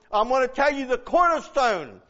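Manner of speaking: ordinary talk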